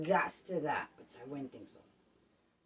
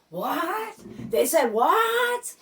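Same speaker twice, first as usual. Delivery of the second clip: high, loud, slow "They said what?"; loud stretched shock; shocked disbelief; 8 semitones higher, 7 dB louder, much slower than usual. Acted. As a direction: drag out the outrage in disbelief. Acted disbelief at blackout news.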